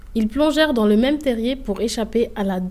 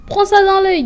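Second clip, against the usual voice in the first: fight taunt; 8 semitones higher, 7 dB louder than usual. A narrator's reading.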